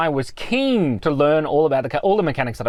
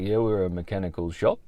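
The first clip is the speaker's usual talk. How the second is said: low, flat mechanic voice; deadpan tradie; 7 semitones lower, 5 dB quieter than usual. Retold or imitated. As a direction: drop the voice, dry.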